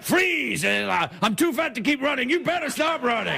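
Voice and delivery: a hint of a winded cop yelling freeze; mock fat TV detective. Comic imitating Detective Cannon chasing criminals, mild mock shout. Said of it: "Remember Cannon, like".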